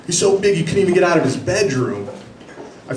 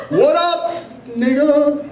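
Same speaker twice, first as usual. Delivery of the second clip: much higher, casual street greeting; imitating street greeting; 12 semitones higher, slower than usual. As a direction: toss off a breezy hello. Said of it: "They're always like".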